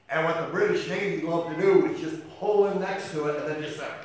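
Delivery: a full-throated preacher's build; preaching at full force. Acted. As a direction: thunder it out from the pulpit.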